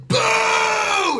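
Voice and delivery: high, long drawn-out boo; mock booing. Inmates jeering an ex-guard on stage, mock booing. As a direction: boo like a hostile crowd. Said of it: "And, like, screaming".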